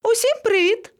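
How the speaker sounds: very high, rising greeting; calling down. A narrator's reading. Monkey greets the animals from her tree, calling. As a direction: sing out a high hello.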